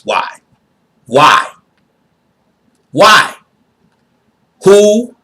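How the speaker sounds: escalating demands; building why. Real, not acted. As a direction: hammer each why harder.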